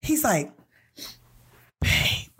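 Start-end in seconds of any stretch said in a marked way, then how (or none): 1.8-2.3 low-voiced raspy suitor imitation; put-on husky, over-familiar voice; raspy "babe". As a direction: mimic a man rasping out a pet name. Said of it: "that's how he's saying it".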